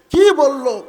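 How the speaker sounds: loud, high demand to know; insistent question. Acted. Ayesha asking what the Prophet told her, insistent loud question.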